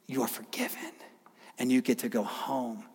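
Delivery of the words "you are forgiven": whisper. Real, not acted. Pastor whispers assurance of forgiveness.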